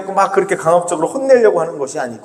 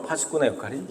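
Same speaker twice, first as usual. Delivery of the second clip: soft echo; 3 semitones lower, 12 dB quieter than usual. Shown as a demonstration. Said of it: "이 말씀을 묵상하기 위해서는".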